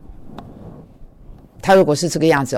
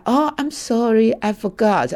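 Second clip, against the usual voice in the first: imitating the roommate's mild apology; gentle apology; 7 semitones higher, slower than usual. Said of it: "他讲话是很温柔的".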